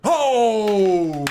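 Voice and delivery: long, high shouted "oh", falling away; loud shocked "oh". Real, not acted. Fan reacting to the live match, loud shocked cry. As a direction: bellow a drawn-out oh of shock.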